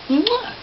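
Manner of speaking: high squeaky kiss; a tiny high smooch; kiss sound. Real, not acted.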